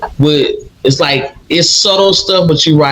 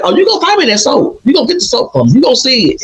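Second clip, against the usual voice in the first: grandma's voice; 8 semitones higher, much faster than usual. Retold or imitated. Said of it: "she's like".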